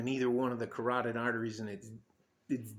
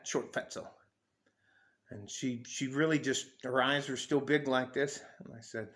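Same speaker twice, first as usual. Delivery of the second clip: ordinary talk, nothing marked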